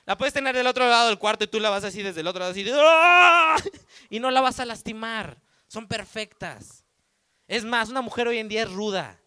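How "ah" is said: loud, higher, playful shout across the room; calling out from across the room, mock yell. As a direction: call out big and silly.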